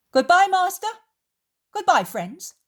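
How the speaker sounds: high, loud farewell; loud, high-pitched greeting to the room; voiced Judas. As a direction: call goodbye loudly, pitch swooping. Judas calls farewell as he leaves.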